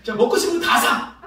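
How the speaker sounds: put-on high, loud call to a group of kids; generous loud announcement. Pastor treating kids at the shop, loud announcement. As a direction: proclaim a treat for everyone.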